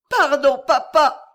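high, loud heartbreaking plea; piercing plea. Acted. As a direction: beg forgiveness, piercing. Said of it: "d'une voix qui perça le cœur".

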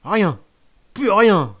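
high, loud groaning complaint; moaning outrage. A narrator's reading. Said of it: "râlait Grand Gibus".

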